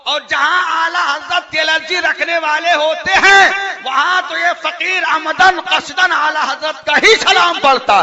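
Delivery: high-pitched preacher's shout; preaching shout. Real, not acted. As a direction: rouse the crowd at full voice. Fiery preacher rousing the crowd.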